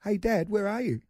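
put-on high little-boy voice; child calling out. Lost son calling for his dad, childlike. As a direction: imitate a small kid calling for a parent.

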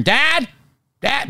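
put-on high toddler "Dad, Dad"; imitating toddler calling. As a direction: call out like a tiny daughter. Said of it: "all of a sudden I'm hearing".

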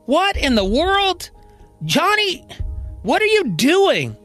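high-pitched, outraged disbelief; shocked scolding. Acted. Marion walks in on Colby smoking, shocked scolding.

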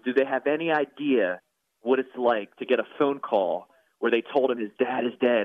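ordinary talk

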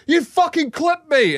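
high, drawn-out mock-outraged yell; imitated angry swearing. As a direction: tear into them like a furious dad, hammed up. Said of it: "Dad is just swearing, tearing shreds off these people".

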